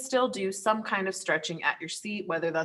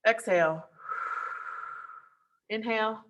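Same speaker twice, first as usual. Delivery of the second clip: breathing demo; 4 semitones higher than usual. Shown as a demonstration. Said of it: "let me do all three deep breaths".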